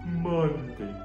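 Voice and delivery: ordinary talk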